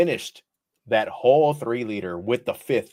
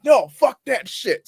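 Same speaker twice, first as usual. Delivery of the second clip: high, loud brush-off; drunken refusal; 14 semitones higher, 5 dB louder than usual. Retold or imitated. Drunk and refusing his friend's warning, loud. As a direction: wave it off like you're drunk.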